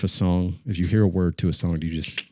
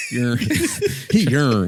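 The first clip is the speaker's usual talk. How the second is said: laughing tease; 5 semitones higher, 5 dB louder, much slower than usual. Real, not acted. Teasing about age.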